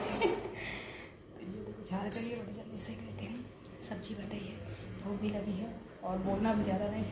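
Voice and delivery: breathy whisper in the kitchen; barely voiced, keeping it secret-quiet; whispering while cooking so the guest isn't disturbed. Real, not acted. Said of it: "एकदम धीरे धीरे बोल रही हूँ".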